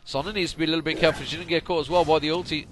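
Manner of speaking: loud, fast, high commentary; excited play-by-play. Real, not acted. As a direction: call the fight as it happens. Fight breaks out, live call.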